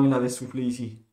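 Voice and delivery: ordinary delivery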